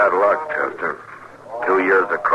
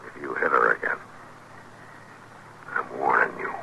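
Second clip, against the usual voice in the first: low quiet warning; 4 semitones lower, 9 dB quieter than usual. Acted. Marshal warns wife-beater.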